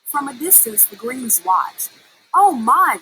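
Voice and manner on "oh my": loud, high "oh my"; shocked chorus. A narrator's reading. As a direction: gasp it out in dismay. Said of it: "they chorused".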